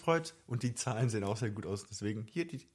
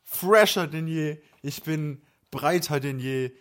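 swaggering boast; 4 semitones higher, 9 dB louder than usual. Pretend. Triumphant return after illness.

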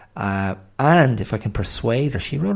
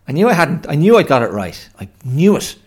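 loud, higher burst of I-knew-it glee; triumphant; 8 semitones higher, 11 dB louder, faster than usual. Real, not acted. Host crowing to himself after checking the song title, loud and gleeful. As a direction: crow that you remembered it right.

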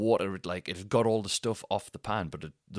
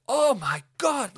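high, loud, drawn-out outcry; retold horrified yell; 8 dB louder, slower than usual. Imitating the drenched neighbour below, high horrified yell. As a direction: cry out in slow, loud horror. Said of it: "I just heard them go".

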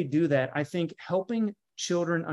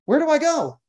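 high, loud, pleading; mock lost planner; 11 semitones higher, 7 dB louder than usual. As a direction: cry out as if lost and asking for help. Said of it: "you're like".

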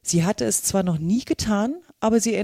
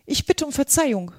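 higher, startled cry of apology; mildly alarmed, raised character voice; 6 semitones higher than usual. A narrator's reading. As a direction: blurt out a shocked apology as Alice. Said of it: "rief Alice schnell, erschrocken".

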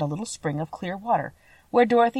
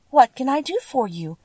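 usual speaking voice

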